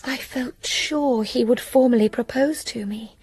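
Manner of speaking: hushed, breathy aside; whispered hope. Acted.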